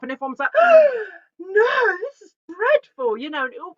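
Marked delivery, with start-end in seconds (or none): 0.5-1.2 sharp, high, loud gasp of mock shock; imitating a scandalised gasp. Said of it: "was all quite shocking". 1.4-3.2 much higher and louder, scandalised protest; hammed-up horrified exclamation; shocked outcry. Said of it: "was all quite shocking".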